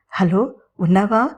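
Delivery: slow, unsure hello into the silence; slow hesitant phone check; doubtful. Acted. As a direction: check if he's still there, hesitantly. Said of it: "అడిగింది సందేహంగా".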